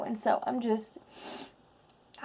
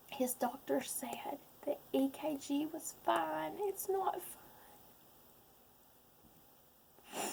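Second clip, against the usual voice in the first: tearful near-whisper, much softer; words squeezed out while crying; real crying, voice nearly gone; choked whisper; 4 semitones higher, 10 dB quieter than usual. Real, not acted. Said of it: "Sorry, guys".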